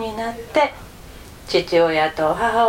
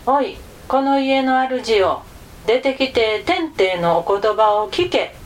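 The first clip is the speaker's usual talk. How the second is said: ordinary delivery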